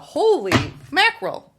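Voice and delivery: high, slow, amazed exclamation. Real, not acted. Reader thrilled by the Magician card, amazed cry.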